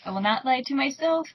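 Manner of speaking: ordinary delivery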